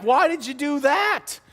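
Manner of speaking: high, loud angry demand; angry outburst. Retold or imitated. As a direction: yell in outrage. Samson upset.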